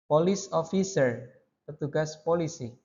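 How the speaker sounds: usual speaking voice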